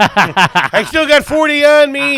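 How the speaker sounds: high, slow put-on goofy voice; mock goofy voice. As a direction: stretch it out in a silly cartoon voice. Mocking friend driving on runway.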